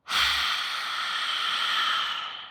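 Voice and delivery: long, voiceless breath out; airy release fading to silence; deep sigh out. Shown as a demonstration. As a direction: exhale slowly in one deep sigh. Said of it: "Then with a deep sigh out".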